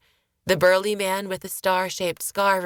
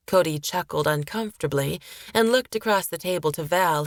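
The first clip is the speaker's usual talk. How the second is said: ordinary delivery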